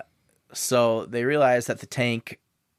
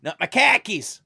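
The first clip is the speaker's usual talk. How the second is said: high, louder mock Boston accent on "khakis"; 11 semitones higher, 4 dB louder than usual. Cohost keeps the Boston bit going, mock accent. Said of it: "you gotta do the Boston accent".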